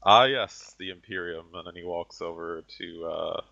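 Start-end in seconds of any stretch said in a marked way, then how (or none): 0.0-1.5 loud mocking "Ah, yes" that drops away to a sneer; sarcastic scoff. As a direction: scoff at the Imperium with contempt. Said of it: "laughs a very loud, sarcastic chuckle".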